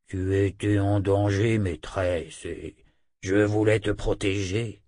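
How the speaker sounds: talking wolf, humble to his mistress; loup parlant soumis. Acted. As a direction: justify yourself as the loyal wolf. (voix de loup soumise)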